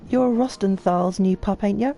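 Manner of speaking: put-on irate old craftsman. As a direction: bristle at the newcomer who nearly hurt him. Voices angry NPC.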